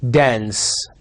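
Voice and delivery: American "dance" with a flat nasal a, /dæ̃ns/; General American. Shown as a demonstration. Teacher contrasting American "dance" for students.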